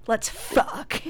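mock coked-out, breathy come-on. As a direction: lightly act a wired, brash visitor. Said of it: "coked out".

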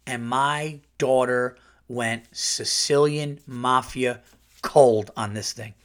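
ordinary talk

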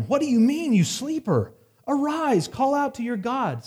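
high, loud, scolding captain yelling at a sleeper; angry ship captain. Acted. The captain shouting at sleeping Jonah, high and loud. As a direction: bark him awake like a furious captain.

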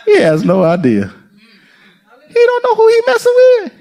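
a light, high, playful taunt; lightly voiced mocking king; God laughing at Satan. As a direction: tease the enemy, amused. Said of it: "'Cause he said".

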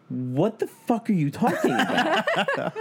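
high, drawn-out disbelief, breaking into laughter; incredulous. Retold or imitated. Acted phone call, disbelieving outburst.

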